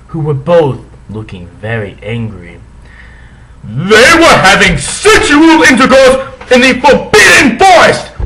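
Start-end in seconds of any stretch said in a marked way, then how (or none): 3.6-8.1 very high loud furious yell. Acted. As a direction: explode with outrage. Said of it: "he yelled in a furious voice".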